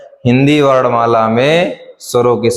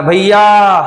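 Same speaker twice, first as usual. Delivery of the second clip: higher, louder call of भैया with the last vowel drawn out; long call; 6 semitones higher, 3 dB louder, much slower than usual. Shown as a demonstration. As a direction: call भैया to someone far away. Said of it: "जैसे बहुत दूर कोई जा रहा हो".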